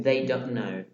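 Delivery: ordinary delivery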